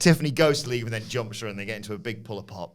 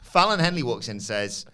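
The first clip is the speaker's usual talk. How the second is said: usual speaking voice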